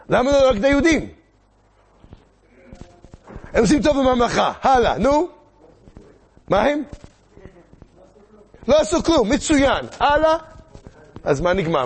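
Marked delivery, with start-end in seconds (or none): 0.1-10.4 loud, high-pitched demands; urgent prodding. Acted. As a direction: fire questions to hurry the crowd. Urging audience for reasons, timed. (דוחק בקהל בקול רם וגבוה)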